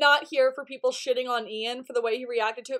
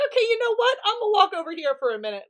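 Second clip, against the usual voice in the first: higher, fast, mock fed-up; exasperated Ian; 7 semitones higher, faster than usual. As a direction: throw up your hands and walk off. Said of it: "And he's like".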